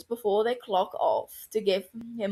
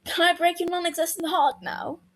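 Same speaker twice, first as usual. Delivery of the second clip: teasing; 10 semitones higher than usual. A narrator's reading. Mary teasing Regulus.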